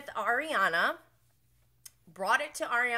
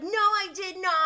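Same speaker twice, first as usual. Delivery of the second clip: denial; 11 semitones higher, 7 dB louder than usual. Retold or imitated. Tom denying in argument.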